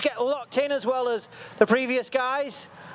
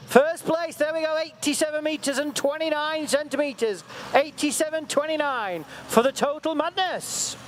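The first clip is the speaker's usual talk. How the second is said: higher, lifted live race call; excited play-by-play; 5 semitones higher than usual. Real, not acted. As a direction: call the pull as it happens, urgent.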